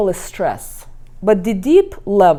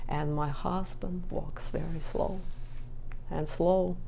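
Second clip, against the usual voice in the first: ordinary talk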